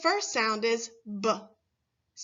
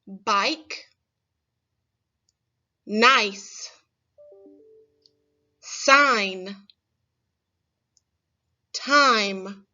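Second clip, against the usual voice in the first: each word on its own, clear and louder; model words for repeating; 5 dB louder, much slower than usual. Shown as a demonstration. Teacher modelling long I words for children to repeat, clearly. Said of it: "repeat these words after me".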